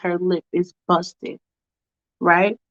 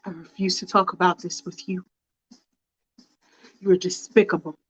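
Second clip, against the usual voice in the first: cold husband; 6 dB quieter than usual. Acted. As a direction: shut her down coldly.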